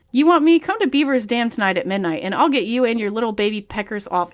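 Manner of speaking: usual speaking voice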